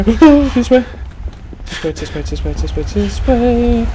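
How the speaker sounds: gasping urgent this way; frantic relief while chased; being chased. Real, not acted.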